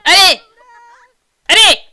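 very loud, high single shouts; urging shout. Pretend. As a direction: yell to hurry them. Hurrying the Sims.

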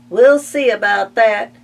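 loud, defiant duck retort. Acted. Ducks vowing to oust the pigs, loud defiant. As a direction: threaten it.

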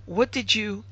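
hesitating. A narrator's reading. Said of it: "asked hesitatingly".

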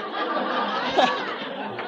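unvoiced breathy laugh. Real, not acted. Laughing at his own story, breathy laugh. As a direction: laugh it off under your breath.

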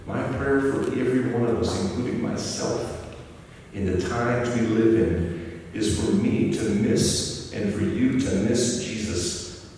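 usual speaking voice

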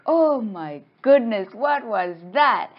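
slow, high, loud exclamation; drawn-out dramatic astonishment; acted shock. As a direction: stretch it out in mock alarm.

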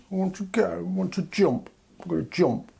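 put-on childish whine. UK as whiny child wanting out.

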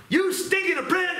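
high-pitched mock yell of abuse; bellowed insult, voice shooting up high; retold yelling. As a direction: yell it like a fed-up, abusive boss. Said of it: "he was always yelling at me".

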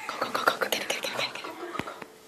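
hushed urgent whisper. Real, not acted. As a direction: whisper it so nobody hears. Hiding, waiting to surprise.

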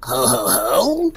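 deep, quiet mock evil laugh; lightly voiced evil chuckle; unhinged villain. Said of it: "That's my evil laugh!".

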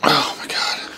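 soft exasperated whisper. Real, not acted. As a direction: breathe it out under your breath. Alone with the bird.

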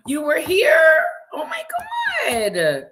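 high, loud astonished question; loud high-pitched you were here; surprised cry. Real, not acted. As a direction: gasp out disbelief that they were so close. Viewer just left her town.

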